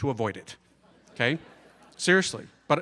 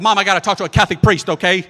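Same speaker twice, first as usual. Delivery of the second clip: loud, rushed blurt; retold desperate outburst, fast and loud; urgent blurt; 2 semitones higher, 10 dB louder, faster than usual. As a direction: burst it out at your mom. Blurting out his confession to mom.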